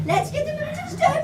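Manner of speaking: usual speaking voice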